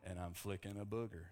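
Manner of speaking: spoof the self-serious car-ad actor; Matthew McConaughey. Retold or imitated. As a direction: secondhand parody, pitched low. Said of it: "making fun of him".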